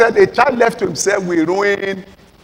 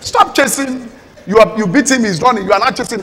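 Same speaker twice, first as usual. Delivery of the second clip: normal talking voice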